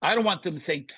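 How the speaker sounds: normal talking voice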